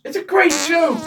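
loud, high insistence on great; emphatic insistence. Real, not acted. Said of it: "If you delivered it like that last time".

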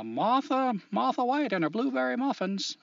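put-on practicing a Maine accent, high; trying out a Maine accent. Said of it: "Read it in a Maine accent".

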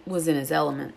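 ordinary delivery

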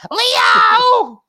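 high, full-force theatrical holler; piercing, drawn-out mock summons; yelling for husband. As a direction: bellow the name through the house. Piper yells for her husband.

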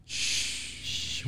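long, soft shushing; shhh, keep it quiet. Real, not acted. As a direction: hush them with a drawn-out shh. Shushing a co-host over lawsuit.

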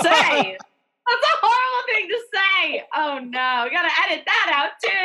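high, squeaky horrified laugh-talk; laughing in dismay. Real, not acted. Host mortified at joking about a guest's dad, laughing. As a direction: giggle through the embarrassment.

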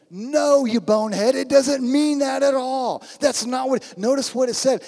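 loud, high-pitched telling-off; exasperated rebuke. Acted. Preacher answering husbands who misuse the verse, exasperated rebuke. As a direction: rebuke them forcefully, drawing out the last words.

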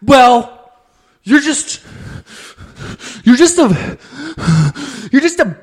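high, sputtering bursts with long pauses; imitated mad kid. Mad boy hunts for comeback at sister, high halting sputter. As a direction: stall angrily, groping for the insult. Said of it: "he was mad because his sister".

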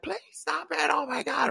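high, pleading mock scream; thin, held-back pretend yell; retold yelling. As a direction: imitate a panicked kid begging, softened in volume.

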